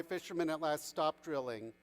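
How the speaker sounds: plain reading voice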